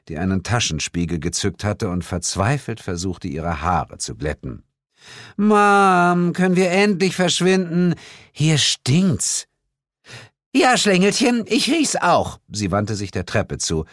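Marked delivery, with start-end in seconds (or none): 4.1-9.5 high, drawn-out complaint; teenage whine. A narrator's reading. Bored teenager whining to her mother to leave, high. 10.5-12.4 high-pitched motherly reply; exasperated mother. A narrator's reading. Mother agreeing with her daughter, high and exasperated.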